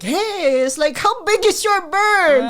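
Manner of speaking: loud, high boisterous joking uncle; Uncle Romy. Retold or imitated. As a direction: call it out big, like the playboy uncle. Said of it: "my Uncle Romy was like".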